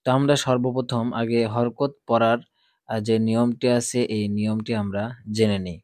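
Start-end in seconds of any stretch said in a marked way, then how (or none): none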